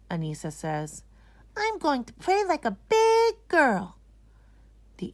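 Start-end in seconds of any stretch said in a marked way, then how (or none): 1.6-4.0 put-on high, loud proud child; proud little girl. Anisa telling Mommy she'll pray, proud child voice. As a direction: announce it like a grown-up little girl.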